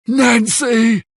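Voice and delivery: very high, held-out cry of a name; horrified cry. Acted. Bill sees dead Nancy in his mind, horrified high cry. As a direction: cry out at a terrible vision. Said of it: "he shouts".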